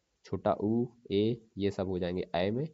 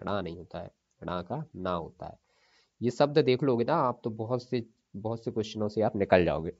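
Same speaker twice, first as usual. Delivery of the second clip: ordinary talk, nothing marked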